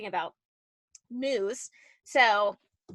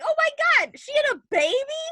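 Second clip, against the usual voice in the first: much higher, incredulous retold reaction; shocked disbelief; 13 semitones higher, 4 dB louder than usual. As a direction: throw it up high in mock disbelief. Retelling shock at the news.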